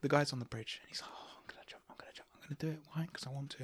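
soft ASMR whisper. Pretend. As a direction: whisper it close and breathy. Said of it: "A S M R about to jump off a bridge".

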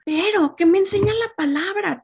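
loud preacher's turn; emphatic preaching. Real, not acted. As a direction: rise high and loud on the question. Turning point of sermon.

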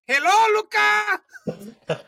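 high, loud, slow and drawn out greeting; hammed-up happy boss calling out a name; Supreme Master voice. Boss greets his recruit in a high beaming voice. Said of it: "now do a changed voice for the Supreme Master".

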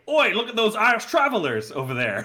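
mock British tough-guy line mocking the movie's realism; mock British tough-guy accent. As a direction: toss it off in a jokey British accent. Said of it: "they're not gonna say".

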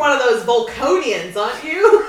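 loud, high, mock-taunting quote; Harry Mudd. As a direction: tease loudly in a hammed-up character voice. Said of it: "Harry, what does he say? He's like".

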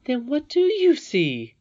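high, loud, slow question; little princess demanding an answer, drawn out; challenging princess. A narrator's reading. Irene challenges Curdie on what he sees, high and insistent.

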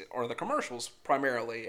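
ordinary talk, nothing marked

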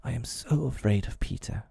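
hushed, fearful confession; shaken fear. A narrator's reading. Tootles dreading Peter's return, hushed and afraid. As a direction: confide the fear softly, nearly whispered.

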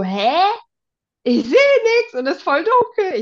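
puzzled, then high loud protest; acted bewilderment. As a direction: blurt it out high and loud, totally baffled. Acts out own bewildered reaction.

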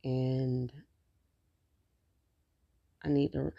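usual speaking voice

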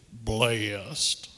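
low, drawn-out voice with chin pulled in; churchman impersonation. Retold or imitated. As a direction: imitate a deep-voiced church brother, rising at the end. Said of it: "That's how he sounded".

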